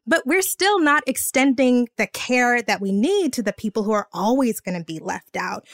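ordinary talk, nothing marked

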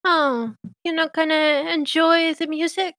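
playfully nudging him to sing; cheerful Meadow. Acted. Meadow cheerfully urging singing.

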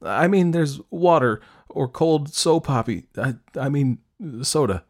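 put-on embarrassed, halting teenage babble; flustered restart. A narrator's reading. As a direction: blurt it and fumble the words. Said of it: "She started again".

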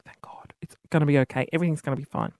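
hushed whisper to herself; whispered relief. Retold or imitated. Owner peeking at her phone, whispered relief. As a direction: breathe it out, barely voiced.

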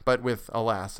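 ordinary delivery, nothing marked

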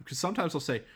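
normal talking voice

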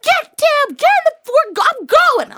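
high, loud panicked shouting; frantic yell. Retold or imitated. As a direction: scream over the revving engine. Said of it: "And I was like".

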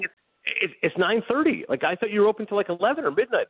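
high-pitched, disbelieving retold complaint; incredulous protest. Diner told by waiter the kitchen's closed, incredulous.

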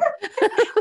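a bright, high burst of laughter. Real, not acted. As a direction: laugh out loud at the irony. Laughing at friend's reaction.

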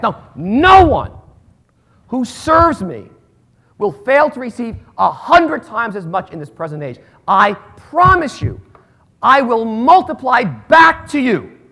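slow, loud, emphatic promise; preaching at full force. Acted. Pastor promising the congregation, slow and loud. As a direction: lean into each word of the promise.

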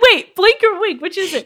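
playfully baffled; mock confusion. Retelling her own mix-up, high mock confusion. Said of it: "I was like".

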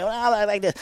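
high, put-on Southern mumble; mock a country oddball up high; exaggerated high Southern impersonation; Crazy Bobby. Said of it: "never spent a day in the South".

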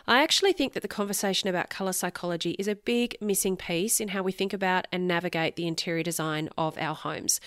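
usual speaking voice

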